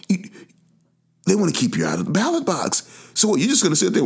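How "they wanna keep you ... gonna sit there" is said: ordinary talk, nothing marked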